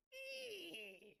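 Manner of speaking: high mock eagle screech; eagle scream. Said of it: "where they're like".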